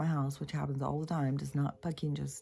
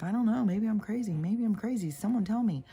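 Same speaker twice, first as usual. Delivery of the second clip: higher, louder mock fretting; imitating a worried lady; 7 semitones higher, 4 dB louder than usual. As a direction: play up her anxious doubt.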